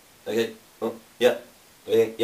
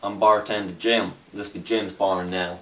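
put-on crazy Southern accent. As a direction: play the old-time Southern barkeep, lightly. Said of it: "That's a crazy accent".

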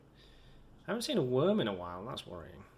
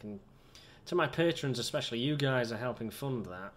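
ordinary delivery

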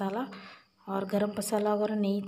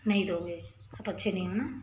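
mock sulk; 3 semitones lower, 6 dB quieter than usual. Mock sulking at baby.

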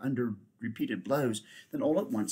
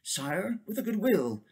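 higher, willing unicorn; eager unicorn; 8 semitones higher than usual. Acted. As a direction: agree readily.

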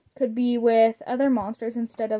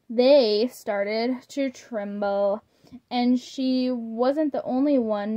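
ordinary delivery

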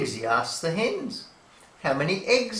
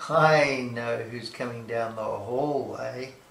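ordinary delivery

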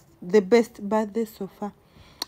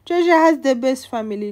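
mock caption; 4 semitones higher, 11 dB louder than usual. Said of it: "Don't see us in videos".

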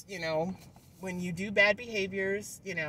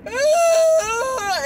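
high, louder wailing cry; 13 dB louder than usual. Retold or imitated. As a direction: break down into a loud wail. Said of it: "I was going".

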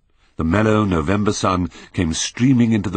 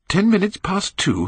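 surprised question; 12 semitones higher than usual. Acted. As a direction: echo the time in a much higher startled question. Dorian repeats valet's answer.

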